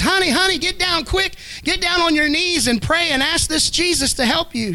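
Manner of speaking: urgent, high pleading; desperate plea. Retold or imitated. Frightened father begging his dying daughter, high urgent pleading. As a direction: beg her to pray, frantic.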